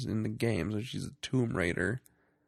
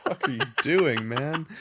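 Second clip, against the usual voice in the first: laughing disbelief; 6 semitones higher than usual. Retold or imitated. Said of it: "I'm like".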